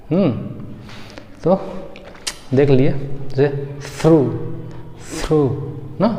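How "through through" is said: THROUGH with a hissed soft th; native th sound. Shown as a demonstration. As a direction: say THROUGH with tongue between teeth, air flowing out. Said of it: "तो ऐसा कुछ बोलेंगे".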